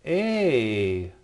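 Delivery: A said as a long "ay", as in eight; A like the start of "eight", held; drawn-out vowel. Shown as a demonstration. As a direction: sound out A by itself, drawn out. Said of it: "like in the number eight".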